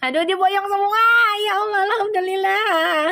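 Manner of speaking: high, overjoyed exclamation; real thrilled outburst in a high voice; amazed delight. Real, not acted. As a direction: cry out thanks, pitched well up. Amazed the whole family is going.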